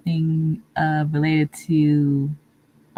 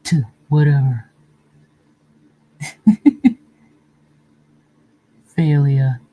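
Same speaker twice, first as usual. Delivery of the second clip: villain voice demo; 2 semitones lower, 4 dB louder than usual. Shown as a demonstration. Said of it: "like, a voice like this".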